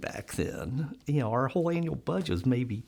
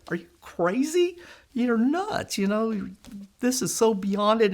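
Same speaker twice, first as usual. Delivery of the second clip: high, half-mocking disbelief; imitating a skeptical board member, pitched up; lightly voiced doubter; mock incredulous; 6 semitones higher than usual.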